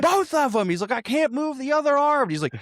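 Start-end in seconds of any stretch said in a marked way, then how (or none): 0.0-0.7 high-pitched barked order; hammed-up arrest order; mock police shout. Cop repeats order louder. 0.9-2.2 high, loud pleading; mock desperate protest. Injured man protests to cops.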